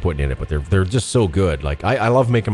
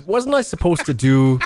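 ordinary talk, nothing marked

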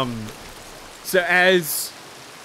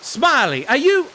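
high, loud joyful greeting; excited reunion shout; delighted; 4 semitones higher, 4 dB louder, slower than usual. Acted. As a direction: greet an old friend with delight.

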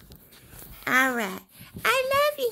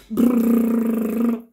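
loud buzzing lips like a massager; massage buzz; 5 semitones lower, 8 dB louder than usual. Pretend. Loud lip buzz mimicking the massage toy. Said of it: "There's the massage".

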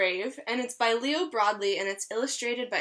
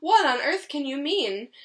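louder, higher offended question; indignant wolf; 4 semitones higher, 5 dB louder than usual. Acted. Wolf scoffing at Pedro's suggestion, offended and loud. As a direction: scoff at the foolish idea. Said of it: "cried the wolf".

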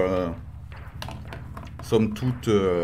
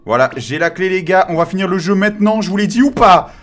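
loud, triumphant cheering; excited shout; 7 semitones higher, 7 dB louder than usual. Real, not acted. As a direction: celebrate loudly to the chat. Triumphant after grabbing the key.

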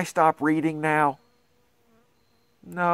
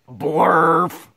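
silly nonsense word; 3 semitones higher, 5 dB louder than usual. Acted. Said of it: "the words say".

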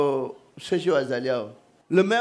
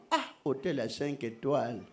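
ordinary talk, nothing marked

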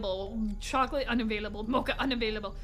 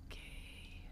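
hushed, nervous whisper; frightened breathy hush; scared whisper; 21 dB quieter than usual. Real, not acted. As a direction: whisper so nothing hears you. Scared whisper in horror game.

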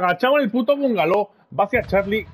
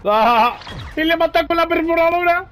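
excited; 8 semitones higher than usual. Real, not acted. Celebrating a kill.

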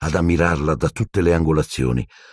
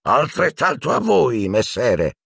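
high hearty knightly roar; cheerful old knight bellowing back; 7 semitones higher, slower than usual. A narrator's reading. Sir Cadogan roaring a reply to Ron, hearty. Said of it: "ruggì Sir Cadogan".

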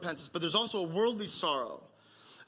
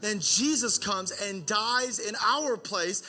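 preaching at full force; 4 semitones higher than usual. Acted. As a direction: drive the gospel home to the congregation. Sermon climax builds.

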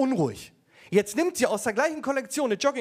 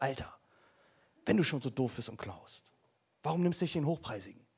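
quiet scolding; 7 semitones lower, 7 dB quieter than usual. Retold or imitated. Chef voiced quietly.

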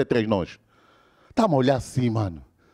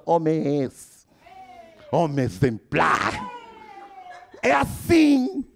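slow grand praise; much slower than usual. Retold or imitated. Friend praises narrator loudly.

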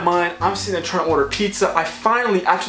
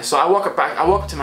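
usual speaking voice

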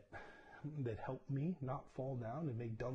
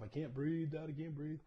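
higher imitation of a panicked crying kid, lightly done; mock panicked kid; 6 semitones higher than usual. Son in his helmet crying to dad, lightly imitated. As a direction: pitch up as a scared boy, a hint of sobbing. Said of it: "Tears, sobbing".

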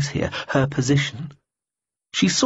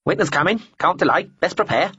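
higher, brisk bird voice; busy talking bird; 7 semitones higher than usual. Acted. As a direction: fuss like a bird readying for winter. Bird voice, high.

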